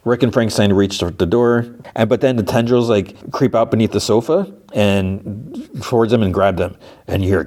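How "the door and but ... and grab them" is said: ordinary delivery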